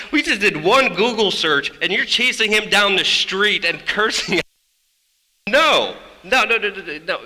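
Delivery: high, loud exasperated rebuke; indignant yelling, retold with gusto; outraged refusal. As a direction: act out an appalled organizer shouting them down. Acting out organizers' outraged refusal.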